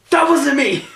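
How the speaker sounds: high, loud panicked shout. Retold or imitated. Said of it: "he goes like this".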